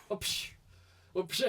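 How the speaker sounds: sharp "psh" of a rifle shot; imitated gunshot. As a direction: mimic the crack of a hair whip. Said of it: "it sounds like a gunshot".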